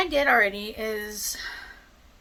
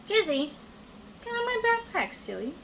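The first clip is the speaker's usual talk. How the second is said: calling the cat; 11 semitones higher than usual. Real, not acted. Scolding cat on backpack.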